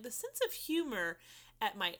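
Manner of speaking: slow, pointed explanation; slow, deliberate quote. Retold or imitated. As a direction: spell it out slowly. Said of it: "I'm like".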